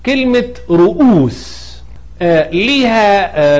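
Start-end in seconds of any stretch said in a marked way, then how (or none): 0.1-1.7 رؤوس said slowly with a clear hamza; slow model word. Shown as a demonstration. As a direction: draw out رؤوس carefully. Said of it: "زي كلمة إيه".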